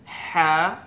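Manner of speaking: "হা" from deep inside the throat; a loud, held "হা" pushed from the throat. Shown as a demonstration. Teacher showing where the letter is made, deep-throated "হা". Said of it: "কণ্ঠনালীর একদম ভিতর থেকে উচ্চারণ হবে".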